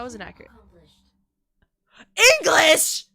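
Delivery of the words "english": loud, high cry of surprise; startled shout. Real, not acted. Streamer suddenly hears English in the game, startled shout. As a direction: shout it out, much louder and higher.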